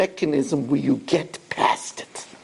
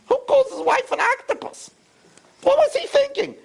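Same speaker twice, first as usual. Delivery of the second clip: indignant wife. Acted. Wife's indignant inner voice.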